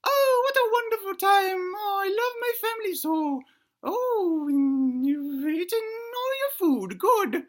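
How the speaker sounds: put-on very high-pitched old granny voice. Granny talking to herself at family dinner, high granny voice. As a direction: play a doting granny in falsetto. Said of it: "she says that to herself".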